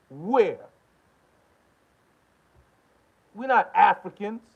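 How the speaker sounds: loud, high-pitched angry challenge; heated rant. Real, not acted. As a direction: fire incredulous questions at full volume. Angry confrontational rant, challenging opponents.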